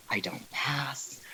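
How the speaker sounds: slow, whispered self-doubt; dismayed inner thought. Retold or imitated. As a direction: whisper it slowly to yourself, mock-crushed. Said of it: "internally, I'm just like".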